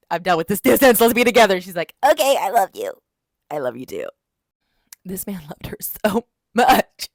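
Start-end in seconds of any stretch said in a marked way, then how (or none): 0.1-1.6 loud, insistent declaration; acted impassioned plea. Hero asks heroine to be together, impassioned acted plea. 2.1-2.9 loud, high put-on girlish voice; mock gushing heroine. Heroine answers her lover's plea, mock gushing. 3.5-4.1 low-pitched put-on man's reply; imitated male lover. Hero answers heroine's confession, imitated low voice. 5.0-7.1 mock sobbing. Reader tells viewers how much he loved her, mock sobbing.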